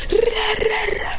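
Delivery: high rolled battle noises; effort noises. Acted. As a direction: imitate rapid-fire ninja moves. Spamming hand signs.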